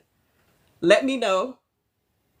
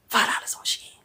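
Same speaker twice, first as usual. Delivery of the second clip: hushed whispered price. Pretend. To the ladies, a whispered shopping secret. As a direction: breathe it out as a secret.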